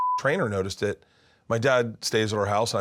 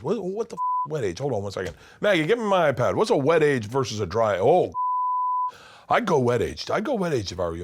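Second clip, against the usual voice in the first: imitating dad; 5 semitones higher than usual. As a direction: fuss over the steak, then call out to Maggie. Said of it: "He goes".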